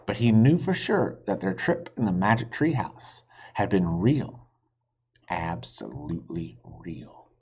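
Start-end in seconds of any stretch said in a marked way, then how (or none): none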